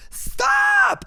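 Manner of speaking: high piercing yell; desperate shout. Retold or imitated. Friend pins the attacker and screams at him to stop, high and piercing. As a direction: scream it to make him quit.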